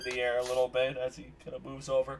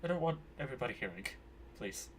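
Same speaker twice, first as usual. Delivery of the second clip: quick, hushed request trailing softer; discreet hushing; 13 dB quieter, faster than usual. Acted. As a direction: keep it down, soft and hurried. Grim hushes an overly loud introduction.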